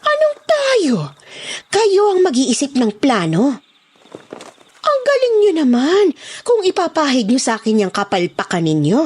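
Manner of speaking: angry scolding. Acted. Boss Emily scolding Alejandro's gang, high and furious. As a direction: tear into them for the failure.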